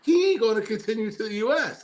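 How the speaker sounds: high-pitched mock certainty; retold scoff, voice pushed way up; knowing disbelief. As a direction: insist on it as if it's obvious. Predicting friend won't leave Amsterdam.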